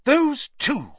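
high, loud burst of anger; furious, high-pitched exclamation; savage outburst. A narrator's reading. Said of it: "she burst out savagely".

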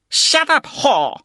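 put-on high, loud German-accented snap; snapped. A narrator's reading. German guest snaps at the woman, loud. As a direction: snap it at her. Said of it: "the German snapped at her".

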